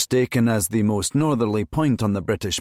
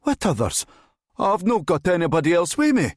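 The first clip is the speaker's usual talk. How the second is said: high-pitched, helpless plea; terrified pleading; 8 semitones higher than usual. A narrator's reading. Driver pleading with the armed officer, helpless. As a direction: plead your innocence in a panic. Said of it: "Helpless and terrified, Bonner pleaded".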